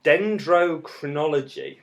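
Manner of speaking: slow, carefully spaced syllables; careful, drawn-out technical word; said slowly. Real, not acted. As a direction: sound out the long term slowly. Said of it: "I'll say this slowly".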